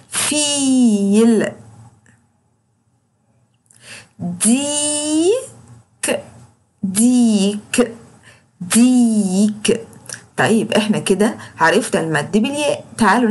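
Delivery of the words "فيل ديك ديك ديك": very slow, ee held long; long drawn vowels. Shown as a demonstration. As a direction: drill each word slowly. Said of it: "وبطول في صوته".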